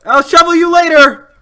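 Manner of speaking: high, loud mock battle cry; playful yelled threat. Real, not acted. Gamer yelling a playful threat at a teammate with a shovel. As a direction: holler it across the game.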